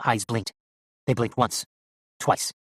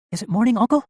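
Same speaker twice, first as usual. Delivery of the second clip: high, louder voice of a sleepy nephew; calling out; 12 semitones higher, 6 dB louder than usual. A narrator's reading. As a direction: call across the room in a high voice. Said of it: "he called".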